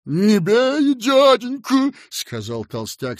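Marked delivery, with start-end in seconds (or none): 0.1-1.9 high, loud frightened whine; cowering plea. A narrator's reading. Fat man cowering before the small boy, cowering plea. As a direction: plead as if shielding your head. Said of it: "съёжившись и закрыв голову руками".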